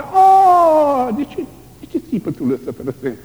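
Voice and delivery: high cry falling into a fast sob; tearful cry. Acted. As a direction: cry out, then break down. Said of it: "voicing Jesus' cry". (strigăt plâns)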